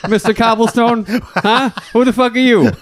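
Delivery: high, pushy mock bully; mock tough-guy taunt. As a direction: get in his face, hammed up.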